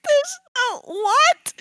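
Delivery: very high, sputtering confusion; lost for words in shock; squeaky high what, rising at the end; flustered disbelief. Retold or imitated. Learning the prank truth.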